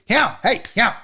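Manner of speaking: a lightly voiced grandpa telling a kid off; old grandpa scolding. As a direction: snap at the kid like a cranky old man. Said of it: "my old grandpa shit".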